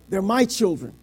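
ordinary talk, nothing marked

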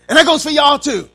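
loud, high mom warning; scolding mother's shout. Retold or imitated. Mother turning on the sisters too, loud scolding. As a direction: holler the warning to the other kids. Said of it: "And then she'd say".

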